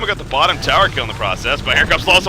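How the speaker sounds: loud, fast-rising hype call; live play-by-play. Acted. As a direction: call the fight like an esports caster. Peak teamfight call, shouting.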